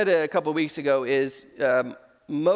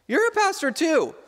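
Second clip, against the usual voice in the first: much higher, mock amazement; imitated surprised recognition; 12 semitones higher, 3 dB louder than usual. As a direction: act out spotting another pastor, pitch leaping up. Acted visitor recognizing the dad.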